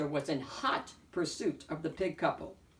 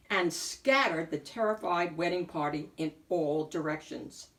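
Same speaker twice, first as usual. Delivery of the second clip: plain reading voice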